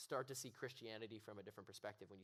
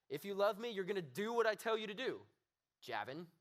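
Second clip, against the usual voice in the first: loud, high scolding demand; disappointed God; 9 semitones higher, 8 dB louder than usual. Shown as a demonstration. Preacher voicing a scolding God to himself, loud harsh scold. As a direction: scold him by name like a let-down father. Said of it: "It was like".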